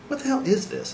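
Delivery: what-the-hell disbelief, voice leaping up; incredulous outburst. Acted. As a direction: shoot up high in disbelief. Narrator exclaims to friends in bar.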